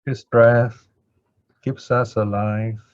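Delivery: ordinary talk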